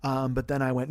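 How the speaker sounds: ordinary talk, nothing marked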